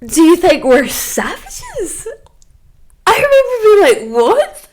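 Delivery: high, slow, incredulous squeal; shocked high-pitched what; laughing outrage. Retold or imitated. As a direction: squeak it in disbelief through laughter.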